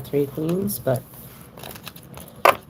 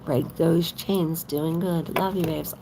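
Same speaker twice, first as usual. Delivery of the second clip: usual speaking voice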